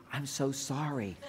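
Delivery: slow, very soft acted apology. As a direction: apologize gently, drawing it out. Voicing what she expects to hear.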